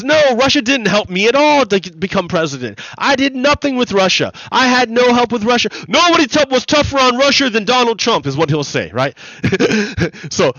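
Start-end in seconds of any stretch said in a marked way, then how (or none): 0.0-8.3 high, loud blustering imitation of a denial; mock Donald Trump voice. Mocking a politician denying any Russian help, high and loud. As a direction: ham it up, pitched high and pushed loud. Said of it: "Donald Trump says".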